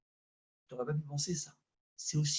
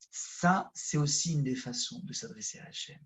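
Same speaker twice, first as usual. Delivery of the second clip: ordinary talk, nothing marked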